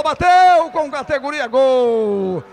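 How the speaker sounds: rising shout into a drawn-out goal; explosive goal commentary. Real, not acted. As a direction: yell the goal, stretch it long. Penalty scored, goal call.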